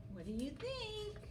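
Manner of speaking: ordinary delivery, nothing marked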